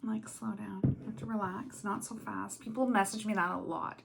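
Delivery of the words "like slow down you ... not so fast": much softer aside to herself; quiet self-talk. Real, not acted. Vlogger coaching herself after rambling too fast, quiet self-talk. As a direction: quietly remind yourself to ease off.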